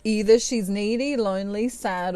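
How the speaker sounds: usual speaking voice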